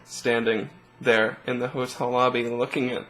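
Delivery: normal talking voice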